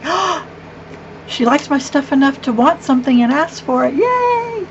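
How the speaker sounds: high, quick, playfully thrilled; gush, rising to a high little yay; giddy delight. Acted. Crafter thrilled that daughter-in-law wants her cards, giddy delight.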